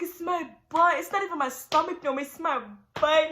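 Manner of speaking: loud, high, emphatic protest; urgent complaint. Retold or imitated. Acting panicked answer during labor.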